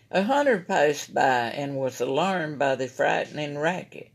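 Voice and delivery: usual speaking voice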